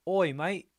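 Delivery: loud, high-pitched mock Australian; Aussie accent. Streamer trying out a movie-learned greeting, loud mock Aussie accent. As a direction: call it out in hammed-up Aussie. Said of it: "an Aussie accent now".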